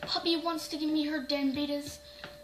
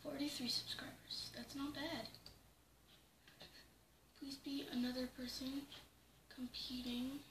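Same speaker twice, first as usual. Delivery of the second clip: hushed whisper; 16 dB quieter than usual. Real, not acted. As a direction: whisper it so nobody hears. Whispering while watching quietly.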